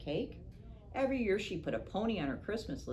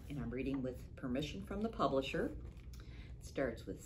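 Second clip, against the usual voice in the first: ordinary delivery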